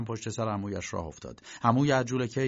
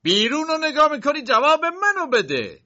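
uncle shouting; 8 dB louder than usual. Acted. Uncle shouting at Josef for staring out the window, loud and high. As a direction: bellow it at the nephew. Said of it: "عمویش داد زد".

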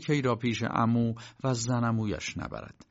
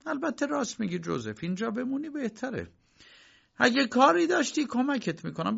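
calmer uncle; 12 semitones higher than usual. Acted. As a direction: promise help gently. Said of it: "کمی آرام شد".

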